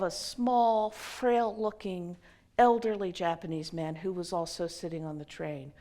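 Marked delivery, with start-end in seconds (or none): none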